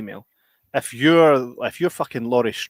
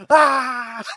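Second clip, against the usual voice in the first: re-enacted scream; 10 semitones higher than usual. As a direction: replay your own cinema shriek in a high voice. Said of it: "And I'm like".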